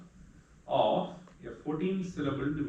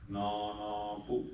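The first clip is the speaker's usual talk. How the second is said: "Nana Pu" said slowly, each syllable weighed; syllables marked out; 4 semitones lower, slower than usual. Shown as a demonstration. Teacher marking long syllables for students, slow and deliberate. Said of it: "Guru Guru Lagu".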